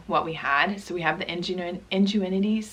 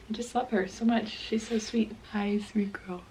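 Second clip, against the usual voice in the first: soft, higher voice, nearly whispered at the end; tender cooing; 4 semitones higher, 5 dB quieter than usual. Real, not acted. Mom adoring her newborn on camera, tender cooing.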